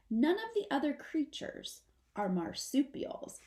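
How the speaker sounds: usual speaking voice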